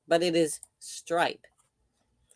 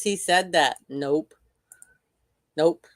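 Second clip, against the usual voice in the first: ordinary talk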